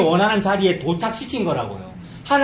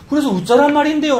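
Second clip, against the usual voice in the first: higher, louder exasperated retort; 8 semitones higher, 5 dB louder than usual. Acted. Preacher voicing baffled listeners of a sermon, exasperated. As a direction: throw it back in frustration. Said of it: "어떤 때는 짜증도 나고".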